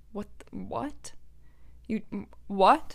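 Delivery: retold stunned stammer, questions rising; speechless, broken-off questions; appalled disbelief. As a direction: stammer in halting disbelief, softly. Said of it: "appalled at that reaction".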